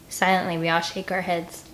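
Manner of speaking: plain reading voice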